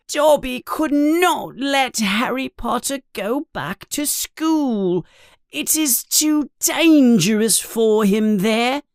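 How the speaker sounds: high, squeaky elf voice, sad and pleading; pleading house elf. Acted. Dobby the house elf pleads with Harry, high and squeaky. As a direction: plead with him like a worried little elf.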